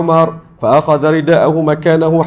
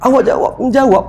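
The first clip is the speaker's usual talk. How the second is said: much higher mock retort from the wife; 11 semitones higher than usual. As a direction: throw the words back, pitched way up. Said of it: "isteri jawab lagi".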